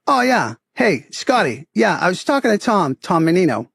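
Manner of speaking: friendly Boston local on the phone; Boston accent. Retold or imitated. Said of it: "I'll play the Boston accent as much as I can".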